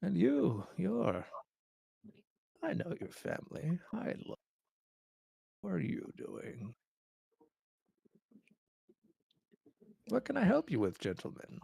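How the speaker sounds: put-on high, frail old man voice; quavering elderly king. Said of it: "the frail old man, Taravangian".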